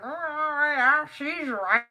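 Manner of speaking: high, loud, drawn-out Southern drawl; uncle's Southern drawl. Retold or imitated. Teasing niece imitates her uncle agreeing, exaggerated Southern drawl. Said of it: "He talks like that".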